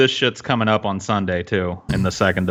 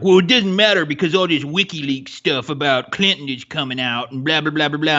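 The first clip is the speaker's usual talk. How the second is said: mock dismissive quote; 3 semitones higher than usual. Podcaster imitating Twitter commenters brushing off the tape, mock dismissive. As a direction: parody the naysayers, tailing into blah-blahs. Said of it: "a lot of people were like".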